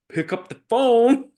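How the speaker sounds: loud, high punch on the answer; emphatic exclamation. Real, not acted. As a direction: belt out the answer to your own question. Rousing answer to own question.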